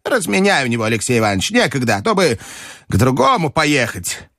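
high, cross granny voice easing slightly; shouting grandmother. A narrator's reading. Grandmother scolding clerk then ordering Aleksei, cross. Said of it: "крикнула бабушка".

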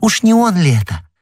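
high, excited exclamation; excited cry. A narrator's reading. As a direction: cry it out high, full of sudden hope. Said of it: "вскричала госпожа Бонасье".

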